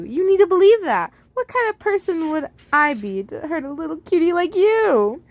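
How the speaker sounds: lightly voiced kindly caretaker. Sunrise reassures the child.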